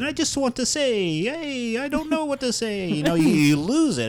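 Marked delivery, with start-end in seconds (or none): 0.0-2.9 high falsetto cartoon Italian; Luigi. Struggling to give the character dialogue, high cartoon Italian voice. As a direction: keep the squeaky character voice going through dialogue. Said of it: "when you have to add, like, dialogue".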